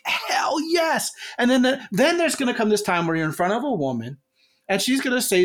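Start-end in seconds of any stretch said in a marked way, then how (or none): 0.0-1.1 high, cracking victory cry; high cheering mock yell; triumphant yell. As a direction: shout it like you just won. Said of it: "you're gonna be like".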